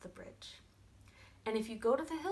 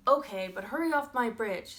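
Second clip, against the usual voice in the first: loud, impatient troll; grudging troll order; 3 semitones higher, 10 dB louder than usual. Acted. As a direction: order the goat off loudly. Troll orders goat off bridge.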